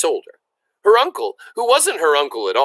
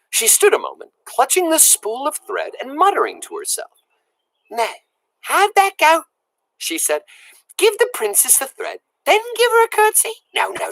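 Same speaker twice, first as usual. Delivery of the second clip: high, eager self-talk; high childlike muttering; muttering excited girl; 11 semitones higher than usual. A narrator's reading. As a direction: rehearse nervously to yourself. Mig talking to herself.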